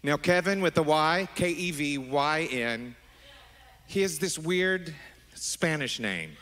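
usual speaking voice

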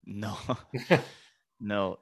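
ordinary delivery

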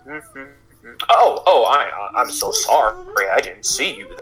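put-on flustered old man, stammering and slow; old man bumping. Old villager bumping into Sierra, flustered old voice. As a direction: fumble the apology like a startled old man. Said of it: "and he goes".

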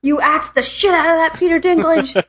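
high-pitched, emphatic mock cheer; gushing fan. Fan urging on Peter Dinklage, gushing.

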